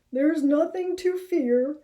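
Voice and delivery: nervous about the creepy woods; uneasy Harold. Acted. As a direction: Harold trying to stay brave. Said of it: "he continued".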